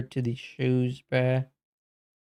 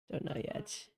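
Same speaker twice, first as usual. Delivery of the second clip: breathy whispered repeat; whispering; 2 semitones higher, 17 dB quieter than usual. Real, not acted. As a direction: whisper it to yourself. Repeats line, then whispers it.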